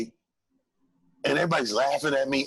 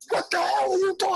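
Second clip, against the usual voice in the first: high, outraged mock yell; angry yell. Road manager scolding the comic, high outraged yell. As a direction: blast him like a furious road manager. Angry crew confronting him offstage.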